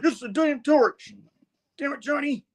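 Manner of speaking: high, pinched cartoon voice for an irritated hero; a hammed-up comic-book gripe, pitched high; annoyed hero grumbling. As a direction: play it as a fed-up cartoon hero. Thing cursing in comic retelling.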